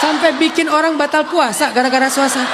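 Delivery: ordinary delivery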